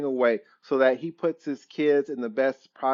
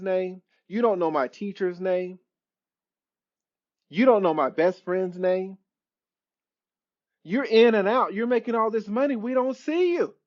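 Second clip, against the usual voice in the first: put-on higher, accusing teenage voice; resentful teenage son; 5 semitones higher than usual. Son confronting his absent father, higher accusing voice. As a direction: throw the absence back at dad. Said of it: "That son will look at you and tell you".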